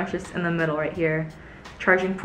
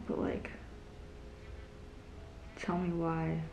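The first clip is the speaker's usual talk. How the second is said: usual speaking voice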